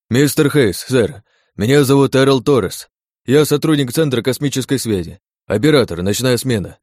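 loud, high, eager report; excited. Acted. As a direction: blurt it out to the boss. Operator rushing to report.

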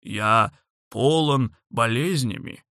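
slow hurt dragon voice; sulky dragon. Acted. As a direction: complain like a wounded dragon. Dragon insists he is ill.

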